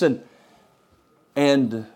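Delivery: normal talking voice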